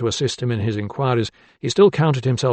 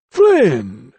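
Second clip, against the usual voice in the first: louder, eager exclamation of the name; excited cry; 7 dB louder than usual. A narrator's reading. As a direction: blurt the name out as it comes back. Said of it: "he cried".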